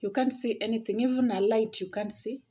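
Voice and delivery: normal talking voice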